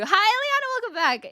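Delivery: high, excited greeting that eases down; delighted high-pitched hello; gushing welcome. Real, not acted. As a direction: squeal a hello to a favourite viewer. Greeting a returning viewer.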